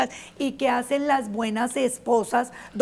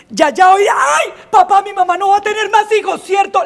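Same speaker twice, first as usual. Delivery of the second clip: higher, loud and fast pleading; hammed-up child panic; panicked shout; 8 semitones higher, 8 dB louder than usual. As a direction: blurt it in a frantic rush. Panicked girl shouting at dad.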